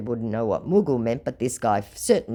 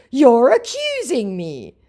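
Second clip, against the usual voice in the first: high, slow whiny complaint; mock scammer whine; 12 semitones higher, 8 dB louder, slower than usual.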